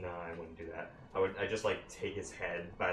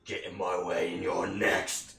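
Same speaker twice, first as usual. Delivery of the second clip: menacing threat; 5 dB louder, slower than usual. Acted. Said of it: "like, say".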